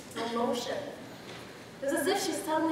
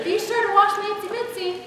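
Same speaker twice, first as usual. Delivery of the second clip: put-on high-pitched babysitter voice; 7 semitones higher, 4 dB louder than usual. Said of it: "She had this high-pitched voice".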